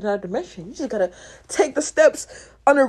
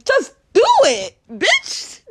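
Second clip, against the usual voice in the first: louder, higher punchline; shouted just do it; punchy slogan; 9 semitones higher, 6 dB louder, slower than usual. Real, not acted. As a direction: bark it like an ad slogan.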